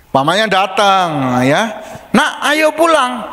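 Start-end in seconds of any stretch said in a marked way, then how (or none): 2.1-3.2 high, slow mother's call. Retold or imitated. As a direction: mum coaxing her child home. Ibu memanggil anak di gereja. (ibu memanggil anak, tinggi dan pelan)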